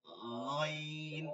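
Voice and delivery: গইন drawn long with the গ rubbing at the back of the throat; slow throaty ghayn. Shown as a demonstration. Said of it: "কফ ফালানোর মতন".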